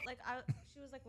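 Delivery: ordinary talk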